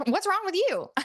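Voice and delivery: high, mock-snippy comeback; acted offence, voice leaping high; bad-attitude retort. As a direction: throw it back with a bad attitude. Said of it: "If you're in a bad attitude, you're like".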